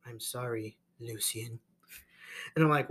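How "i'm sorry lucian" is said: softer, hushed mock apology; mock solemn apology. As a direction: murmur it like a hammed-up farewell. Acting out Morbius's apology.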